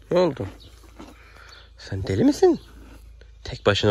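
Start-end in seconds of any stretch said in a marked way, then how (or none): 1.8-2.6 high, mock-shocked; are you crazy, high and teasing; playful tease. Real, not acted. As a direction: tease her fondly. Teasing the horse.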